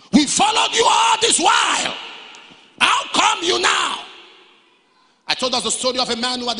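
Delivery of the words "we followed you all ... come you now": shouted protest. Retold or imitated. Preacher voicing disciples who followed him all this while, shouted protest.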